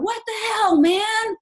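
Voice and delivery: high, loud, slow mock complaint; hammed-up angry customer, high and loud; mock angry rant. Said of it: "if I'm just sitting there going".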